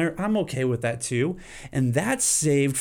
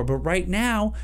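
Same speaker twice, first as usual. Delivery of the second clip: normal talking voice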